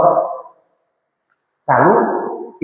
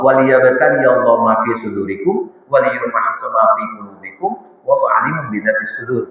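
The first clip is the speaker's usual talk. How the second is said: lower, measured Arabic recitation; Quran recitation; 5 semitones lower than usual. Acted. Preacher reciting a Quran verse to the congregation, reverent.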